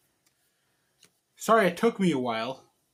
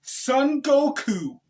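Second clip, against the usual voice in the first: high, very slow cartoon hero voice, much louder; Son Goku impression; 10 semitones higher, 7 dB louder, much slower than usual. Announcing himself to viewers as an anime hero, high and slow. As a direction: puff up as a spiky-haired anime fighter. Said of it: "Just kidding".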